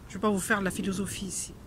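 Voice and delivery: usual speaking voice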